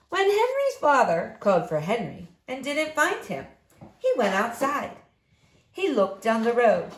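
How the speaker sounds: plain reading voice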